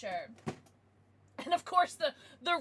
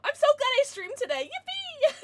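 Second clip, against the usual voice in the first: much higher and louder happy cry; overjoyed cheer; 13 semitones higher, 10 dB louder than usual. Real, not acted. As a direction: squeal with pure delight. Overjoyed after the card pull.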